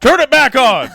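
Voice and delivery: high, loud pretend order; mock commanding shout. Co-host to listener who switched off, mock shout.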